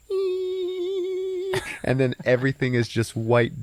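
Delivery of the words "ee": very high, thin sustained "eee"; imitated ringing noise. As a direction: imitate ears ringing after a crash. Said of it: "all you hear is".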